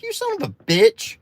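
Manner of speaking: high, squeaky mock curse; mock outrage. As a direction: jump way up in pitch for the insult. Mock outrage at dealer's profit.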